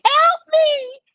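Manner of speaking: high shrieking cry of help me; mock scream for help. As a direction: wail it like someone trapped and desperate. Neighbours screaming from locked buildings.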